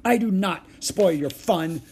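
loud, high, slow angry denial; furious little kid protest; storming. A narrator's reading. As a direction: storm back like an outraged child. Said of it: "stormed Ramona".

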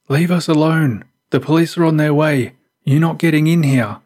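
high, defiant narrated yell. A narrator's reading. Boy fed up with the intruder outside, yelling. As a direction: yell a warning to drive someone off. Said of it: "he yelled".

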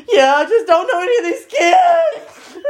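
tearful high wail; crying. Retold or imitated. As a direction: sob it out loud. Crying while answering friends.

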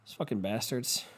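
usual speaking voice

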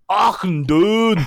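high, loud, stretched Scottish cry; Scottish dwarf accent. Acted. Explaining the dungeon joke to James, Scottish dwarf bellow. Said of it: "he's got a Scottish accent".

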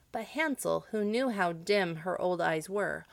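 plain reading voice

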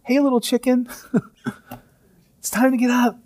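high, playful, with a laugh; fond wake-up call. Retold or imitated. Jesus waking the little girl, playful and fond. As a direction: tease a sleepy child out of bed. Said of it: "this is how he sees her".

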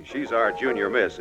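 ordinary delivery

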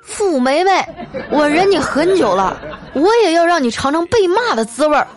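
mock-furious husband finally standing up to his wife; fed-up dad blowing up. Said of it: "终于把我爸给整急眼了".

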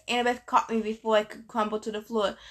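plain reading voice

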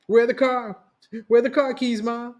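a son rushing for the car keys; acted exclaim. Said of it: "Brandon exclaimed".

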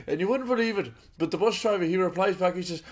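loud, high, excited Irish storytelling; Irish accent. Pretend. Storyteller setting up the bus driver's reply, excited Irish accent. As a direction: build to the punchline in Irish. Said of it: "talking in an Irish accent".

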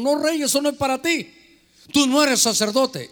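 high, firm priests' rebuke; priests refusing. Retold or imitated. Priests telling King Uzziah no, firm rebuke. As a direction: forbid the king sharply.

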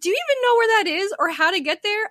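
high-pitched incredulous challenge; retold exasperated retort. Daughter retelling her retort to lost mom, exasperated disbelief. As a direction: throw it back at her in disbelief.

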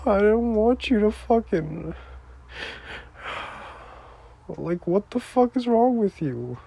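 high-pitched tearful pleading; upset, wailing appeal; real crying. Real, not acted. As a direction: plead through tears, voice lifted. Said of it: "I'm not supposed to be crying".

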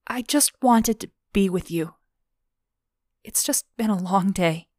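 tearful pleading. Acted. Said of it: "wiping her eyes".

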